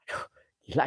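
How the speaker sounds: scared whisper. Real, not acted. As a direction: gasp it out under your breath. Frightened in haunted place.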